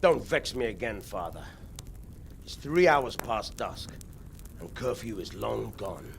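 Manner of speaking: low, quiet official's warning; stern warning. Acted. Bailiff warns priest that curfew is gone, stern. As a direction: warn them off, voice kept low.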